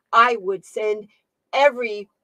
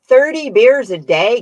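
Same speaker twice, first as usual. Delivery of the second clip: shocked exclamation; 5 semitones higher, 12 dB louder than usual. Real, not acted. Reacting to chat about aunt drinking.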